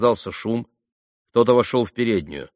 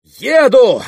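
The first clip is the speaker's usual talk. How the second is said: very high, loud, decisive cry; ringing, impulsive shout; sudden exclamation; 11 dB louder than usual. A narrator's reading. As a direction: burst out all at once. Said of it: "воскликнула она вдруг".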